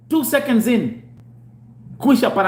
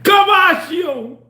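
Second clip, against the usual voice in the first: slow, drawn-out loud call; slow shout; 10 semitones higher, 6 dB louder, much slower than usual. Retold or imitated. Shouting slowly at the caller. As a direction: bellow it out slowly.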